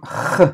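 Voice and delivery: H made as a raspy "kh"; throaty G sound. Shown as a demonstration. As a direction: scrape out H at the back of the throat. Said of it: "när uttalas bokstaven G som H".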